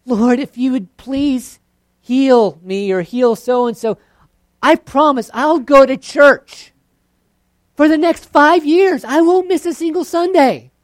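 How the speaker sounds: high, loud, slow bargaining; mocking a bargaining prayer, high and loud. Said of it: "You might have the contract".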